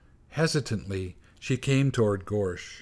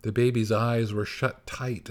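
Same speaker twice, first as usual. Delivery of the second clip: ordinary delivery, nothing marked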